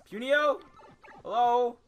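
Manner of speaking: shouting into the void, high and loud; calling for a vanished friend. Real, not acted. Player calls for missing Punio, loud searching shout. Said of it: "Man's just vanished".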